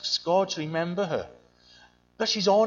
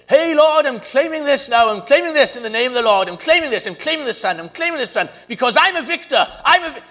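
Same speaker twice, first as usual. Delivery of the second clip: loud hammed-up claiming prayer, higher; mock a triumphant shouting prayer; silly shouting; 7 semitones higher, 9 dB louder than usual. Preacher mocking a showy prayer, loud and high. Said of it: "silly shouting".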